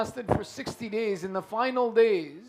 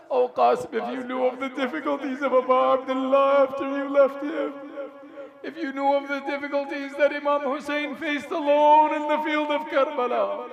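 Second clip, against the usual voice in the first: sustained, raised wailing recitation; mournful lament; 4 semitones higher, 5 dB louder than usual. Acted. Preacher calls to dead Qasim about Hussein's suffering, mournful lament. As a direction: cry out an elegy on long high notes.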